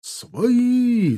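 high, carrying cry; calling out. A narrator's reading. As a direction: call from afar to be let through. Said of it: "донеслись возгласы". (высокий протяжный возглас издали)

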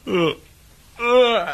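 loud, high groan on waking. Acted.

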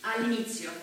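ordinary talk, nothing marked

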